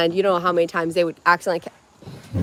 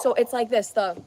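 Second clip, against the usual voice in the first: normal talking voice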